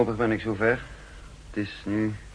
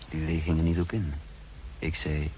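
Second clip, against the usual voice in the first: calm inward narrator voice, set apart from the dialogue; story narration; 4 semitones lower, 4 dB quieter than usual. A narrator's reading. Narrator looking back on lunch with Lillet, calmly told. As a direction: tell it like a memoir read aloud.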